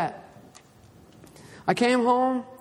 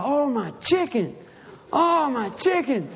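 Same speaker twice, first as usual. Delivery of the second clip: mock alarm; 4 semitones higher, slower than usual. Retold alarm at burning chicken.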